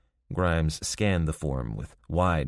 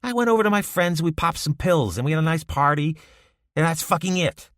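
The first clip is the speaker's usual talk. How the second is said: much higher and louder, shouting now; getting louder; 12 semitones higher, 5 dB louder than usual. A narrator's reading. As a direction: raise your voice, defiant. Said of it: "his voice getting louder".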